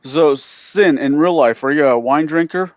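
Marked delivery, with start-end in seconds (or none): none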